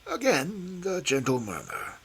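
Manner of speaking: ordinary delivery, nothing marked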